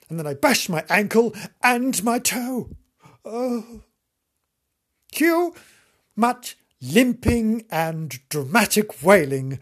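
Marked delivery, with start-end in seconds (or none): none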